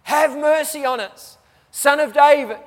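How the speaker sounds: desperate loud plea for mercy; crying out for mercy. Acted. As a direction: beg loudly like a blind man calling. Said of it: "they cry out".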